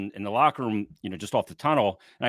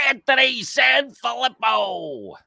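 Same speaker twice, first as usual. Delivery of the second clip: high, drawn-out announcer introduction; Howard Callis; 13 semitones higher, 6 dB louder, slower than usual. Acted. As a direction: stretch the name out like a big intro, pitched way up. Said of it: "in the great Howard Callis voice".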